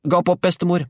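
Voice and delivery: high, urgent shouted order; boy calling out. A narrator's reading. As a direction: call it out urgently, pitched high. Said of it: "ropte han".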